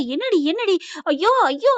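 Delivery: high, alarmed questioning; mock panicked yell. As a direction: act out startled panic. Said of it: "அப்படின்னு கத்திட்டு".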